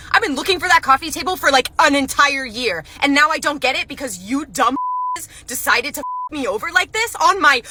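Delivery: loud, high aggrieved complaint; angry customer rant. Acted. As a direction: build the grievance toward an outburst. Customer rants at manager.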